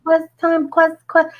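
put-on high, eager little boy voice; child's voice, high. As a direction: chant it like an excited kid. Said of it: "he keeps saying".

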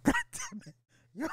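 high squeal of laughter, then a cursed-out aside. Real, not acted. Streamer cracks up retelling his night terror, high squeal. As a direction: crack up at yourself.

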